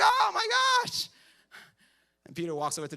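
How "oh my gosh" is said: high, loud startled shriek; startled shout. Retold or imitated. Disciples shocked as Jesus appears, high startled shriek. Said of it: "And they're like".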